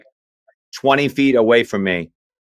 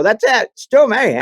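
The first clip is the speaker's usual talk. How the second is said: triumphant; 10 semitones higher than usual. Retold or imitated. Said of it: "I go".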